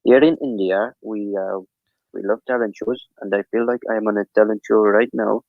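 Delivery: put-on Indian accent. Shown as a demonstration. Shows streamers another accent, Indian accent. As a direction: play a talent-show hopeful with an Indian accent. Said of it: "you like my Indian accent?".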